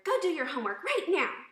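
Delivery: loud, high shouted order; angry yell. Shown as a demonstration. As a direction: order a child about at full volume. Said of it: "Notice my tone of voice".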